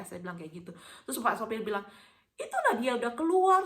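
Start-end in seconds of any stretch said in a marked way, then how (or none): 2.4-3.6 high, loud put-on driver voice; excited announcement. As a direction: call out the sighting, imitating the driver. Driver announces he has come out. (tiruan sopir berseru heboh)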